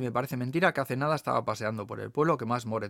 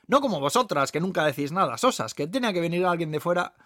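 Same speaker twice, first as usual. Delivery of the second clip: louder, raised scolding of regulars; teasing waitress rebuke; 5 semitones higher, 6 dB louder than usual. Acted. Waitress chiding the ladies next table, mock scolding. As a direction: tell them off, loud and lively.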